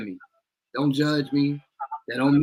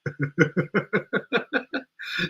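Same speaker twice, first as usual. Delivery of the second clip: higher rippling laugh; long laugh; 5 semitones higher, 4 dB quieter than usual. Real, not acted. Co-host cracking up at mama's retort, laughing.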